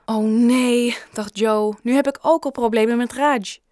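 loud dismayed groan of a thought. A narrator's reading. Boy realises he's in trouble with Raj, alarmed.

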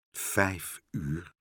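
soft, low and glum; quiet gloom; gloomy. A narrator's reading. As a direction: say it sombrely, voice sinking. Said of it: "zei hij somber".

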